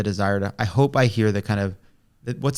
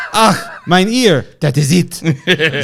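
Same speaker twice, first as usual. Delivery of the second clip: put-on slow, pained German accent, louder and higher; a cartoon dictator in German accent, stung by pain; pained cry in a German accent; thick German accent; 4 semitones higher, 4 dB louder, much slower than usual. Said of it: "in a thick German accent".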